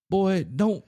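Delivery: country trooper's quick high warning; warning cop. Retold or imitated. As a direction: cut the boy off before he moves. Trooper warning nervous buddy.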